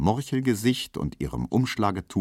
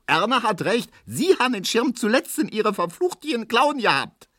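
shrieking woman. Acted. Frau Ziebland screeches at grandmother over the lost umbrella. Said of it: "kreischt Frau Ziebland".

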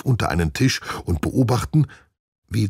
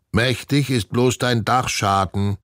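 high parrot squawk; croaking; 7 semitones higher, 3 dB louder than usual. A narrator's reading. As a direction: croak back a cheeky retort. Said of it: "krächzt Herr Klodwig". (frech krächzender Papagei)

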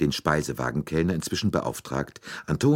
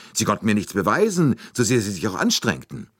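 higher and louder, triumphant fall on beweisen; defiant boast; 7 semitones higher, 5 dB louder, faster than usual. A narrator's reading. Suspect gloats to Poirot about the police, defiant. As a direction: crow that they proved nothing.

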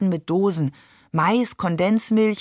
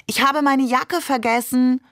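higher-pitched, lightly raised call; mild narrated call, pitched up; 6 semitones higher than usual. A narrator's reading. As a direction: call it out for the next room to hear, without shouting. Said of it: "rief sie laut".

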